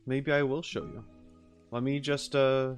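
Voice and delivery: usual speaking voice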